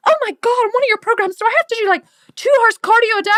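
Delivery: hammed-up dismay at the workload; mock panicked client. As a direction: squeak it out like a horrified client. Client panics about cardio.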